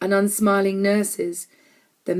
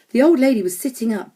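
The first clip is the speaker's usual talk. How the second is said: ordinary delivery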